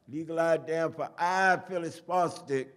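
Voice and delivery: high, loud street-poet declamation; shouted out. Acted. Poet recites as the crowd stops, shouting out. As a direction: holler each word of the verse to a crowd. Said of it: "and I shouted out".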